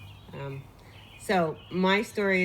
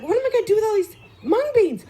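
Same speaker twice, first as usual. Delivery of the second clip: much higher, fast, loud bafflement; bewildered; 13 semitones higher, 5 dB louder, faster than usual. Retold or imitated. Storyteller's own baffled thought left holding mung beans. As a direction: sound stuck with a huge bag. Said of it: "And I'm like".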